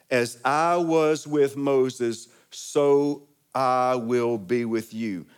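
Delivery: slow, weighty scripture reading; solemn slow voice of God. Acted. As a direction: let each word of God's promise land slowly. Quoting God's promise slowly.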